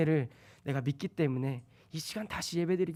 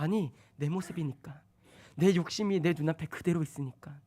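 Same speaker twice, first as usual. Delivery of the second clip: ordinary talk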